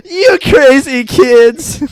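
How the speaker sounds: dad to kids, hammed-up slow chuckle line; mock dopey dad. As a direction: drawl it fondly like a goofy dad. Voicing dad in sketch.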